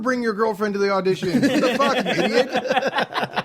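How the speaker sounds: high, loud outburst of disbelief; incredulous rant, voice shooting up; exasperated scolding. Real, not acted. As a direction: scold the fool at full pitch. Scolding an absent contestant, others laugh.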